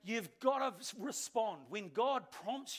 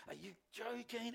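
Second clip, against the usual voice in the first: inner grumble; 7 dB quieter than usual. Retold or imitated. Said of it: "On the inside".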